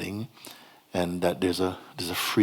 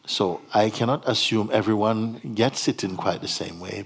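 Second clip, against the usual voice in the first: ordinary delivery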